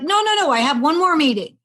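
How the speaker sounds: high, loud, insistent no; frantic protest. Retold or imitated. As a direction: brush them off in a rush of panic. Panicked refusal to paramedics.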